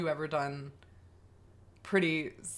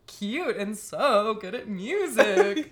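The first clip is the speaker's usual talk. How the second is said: swooning; 4 semitones higher, 7 dB louder, slower than usual. Retold or imitated. Gushing over a crush.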